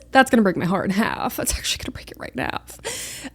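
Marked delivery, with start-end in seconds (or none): 1.4-3.3 very soft, breath-only aside; heartbroken whisper. Real, not acted. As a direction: whisper it as if your voice gave out. Overcome by heartbreak, voice drops away.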